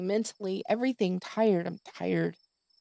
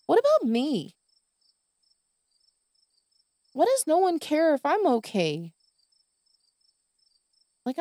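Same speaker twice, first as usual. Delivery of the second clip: upset rant; 6 semitones higher than usual. Real, not acted. Podcast rant about caring for everyone, high upset outcry. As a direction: voice climbing in hurt outrage. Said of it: "when I do act like this".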